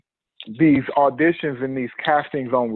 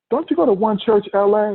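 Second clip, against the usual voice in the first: surprised recognition; 7 semitones higher than usual. Retold or imitated. Casting guy recognizes him.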